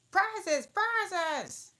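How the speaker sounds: high, loud jumbled crowd cries; confused calling out. A narrator's reading. Party crowds around Alice, calling out confusedly. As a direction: shout it over each other, all at once. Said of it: "calling it in a confused way".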